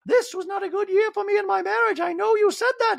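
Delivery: very high, forceful impression; theatrical high-pitched outburst; Dorit. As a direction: protest in an affected piercing high voice. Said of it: "Then we see Dorit going".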